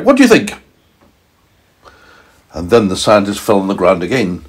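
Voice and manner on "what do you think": high, cheeky squeak; meteorite voice. A narrator's reading. Meteorite retorts to scientist, high cheeky. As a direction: answer as a proud little rock. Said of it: "said the meteorite".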